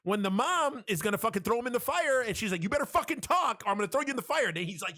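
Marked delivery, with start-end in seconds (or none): 2.6-4.5 high, heated mock ultimatum; imitated angry threat. Mom threatening to burn the doll, imitated angry threat. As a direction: act out a threat, pitched well up.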